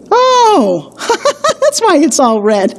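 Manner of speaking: high laughing "oh" of relief; laughing realization. Retold or imitated. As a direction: laugh as it dawns on you. Realizing the beet joke, laughing.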